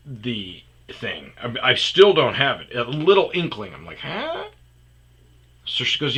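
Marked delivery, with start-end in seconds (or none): 4.0-4.5 high squeaky confused huh; a baffled jump up in pitch. Retold or imitated. Realizing the date is a booty call, baffled squeak.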